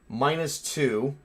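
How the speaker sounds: usual speaking voice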